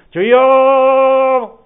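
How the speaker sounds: 높고 길게 뽑는 우렁찬 외침; 심야 기도회에서처럼 온 힘으로 부르짖기; 큰 소리로 부르짖는 기도. Acted. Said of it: "큰 소리를 기도한다고". (목청껏 길게 부르짖듯)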